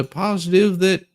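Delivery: normal talking voice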